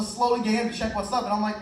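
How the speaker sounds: usual speaking voice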